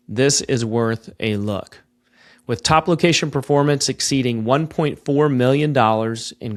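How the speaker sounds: smooth, measured sponsor read. Acted. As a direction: read it like a radio spot.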